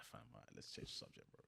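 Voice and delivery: whispered aside. Retold or imitated. Quiet aside to change subject.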